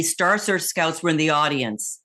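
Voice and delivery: ordinary delivery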